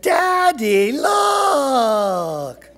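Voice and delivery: high, loud, drawn-out call to dad; excited child's shout. A narrator's reading. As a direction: yell like an excited kid, stretching it out. Child calls for father's attention.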